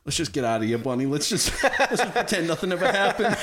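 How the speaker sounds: high, laughing imitation of fear; mock frightened. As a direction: plead to leave, breaking into laughter. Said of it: "O'Neal's like shaking in his boots".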